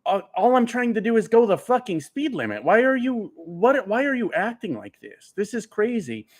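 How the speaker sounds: pitched high, retelling his own baffled pleading; exasperated disbelief. Retold or imitated. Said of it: "And I'm like".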